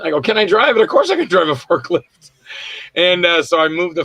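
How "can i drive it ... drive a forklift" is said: higher, louder incredulous retort; mock indignation. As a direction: bounce the question back, cocky and emphatic. Mock-indignant answer to boss.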